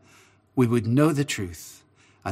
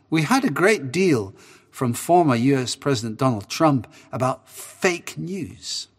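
ordinary talk, nothing marked

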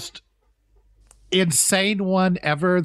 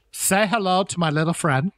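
usual speaking voice

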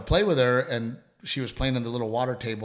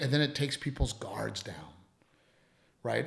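normal talking voice

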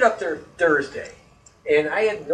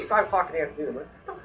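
ordinary delivery

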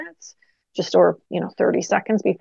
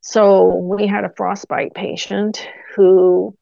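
ordinary talk

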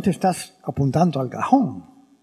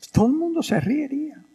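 much higher, quicker restatement; emphatic repeat; 9 semitones higher, faster than usual. Shown as a demonstration. Priest stressing to listeners, higher and emphatic. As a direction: lift it to drive the point home.

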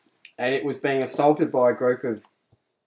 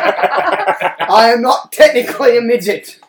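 loud, slow, higher objection; indignant mock protest; 8 semitones higher, 7 dB louder, slower than usual. Real, not acted. Teased friend objects to being called a midget, loud mock indignation. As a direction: protest loudly, word by word.

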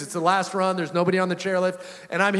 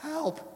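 weak, high moan for help, acted out; 11 semitones higher, 10 dB quieter than usual. Injured skier alone on the mountain, feebly moaning for help. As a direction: strain out a feeble, high cry. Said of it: "I'm moaning".

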